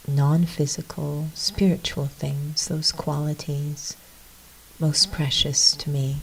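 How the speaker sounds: soft, unhurried reflection with long pauses; guided meditation. Real, not acted. Leading listeners into a little reflection, soft meditation voice. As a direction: lead them inward quietly.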